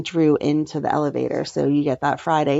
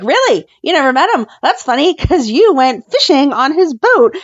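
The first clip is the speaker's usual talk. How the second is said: incredulous challenge; 7 semitones higher, 3 dB louder than usual. Retold or imitated. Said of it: "She was like".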